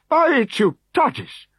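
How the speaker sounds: slow, high and loud oath; high slow outraged swearing; exclaimed oath. A narrator's reading. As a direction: swear it out slowly in shock. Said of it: "he exclaimed".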